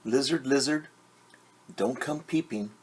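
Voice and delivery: ordinary delivery, nothing marked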